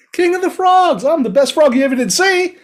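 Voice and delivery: very high, loud frog voice; squeaky, swaggering little braggart; boastful frog. A narrator's reading. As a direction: crow about yourself to the tiger.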